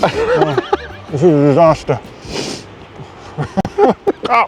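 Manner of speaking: sniffly with a runny nose. Real, not acted. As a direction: talk through a runny nose. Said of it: "my nose is running".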